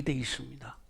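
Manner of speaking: whispered close. Real, not acted. The preacher ends his point to the congregation, whispered. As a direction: let it fall into a whisper.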